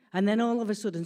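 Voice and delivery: usual speaking voice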